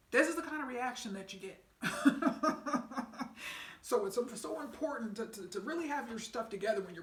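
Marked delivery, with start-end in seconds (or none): 1.8-3.8 breathy burst of laughter; real laugh. Real, not acted. Speaker laughing about the scammer's reaction, real laugh. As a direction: laugh out at how bad it is.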